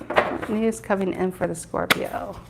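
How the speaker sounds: soft, musing refrain; murmured repetition. Real, not acted. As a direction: repeat it quietly, as if to yourself. Murmuring repeated question during card reading.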